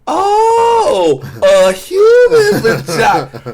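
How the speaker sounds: very high, drawn-out mock amazement; hammed-up loud cartoon wail of wonder; King Shark. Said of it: "And King Shark goes".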